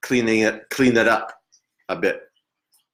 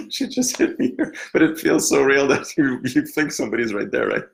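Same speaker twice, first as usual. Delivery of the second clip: laughing; 6 semitones higher than usual. Real, not acted. Medium explaining the spirit's slap to the sitter, laughing. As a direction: tell it while still cracking up. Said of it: "I have to compose myself here".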